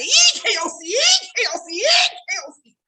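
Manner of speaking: high shouted spirit cry, EKOC; church shout. Retold or imitated. Churchgoer hollering in the pews, high shout. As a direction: holler it like catching the Holy Ghost. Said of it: "y'all hear somebody say".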